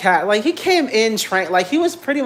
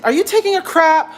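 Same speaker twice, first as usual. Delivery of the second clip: higher and louder, caught off guard; surprised call-out; 10 semitones higher, 6 dB louder than usual. Real, not acted. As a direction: blurt it out at the cat mid-sentence. Notices cat using litter box.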